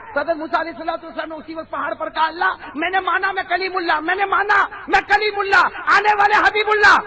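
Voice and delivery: loud, high preaching voice; booming sermon. Acted. Preacher booming to the congregation at full force. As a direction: preach it out to the crowd.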